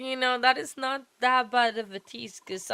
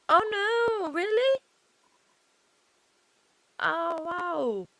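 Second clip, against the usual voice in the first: much higher, louder "oh no, really?"; shocked disbelief at the news; surprised; 10 semitones higher, 4 dB louder, slower than usual. Real, not acted. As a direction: gasp it out in real surprise.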